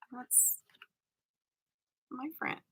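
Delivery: very soft mock-jealous murmur; hushed possessive jealousy. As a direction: say it barely audibly, as if to yourself. Acts own secret possessive jealousy.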